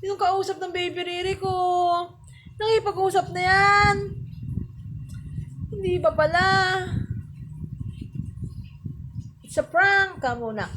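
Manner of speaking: high, loud excited chatter. Real, not acted. Excited talk about baby. (masiglang pakikipag-usap sa sanggol)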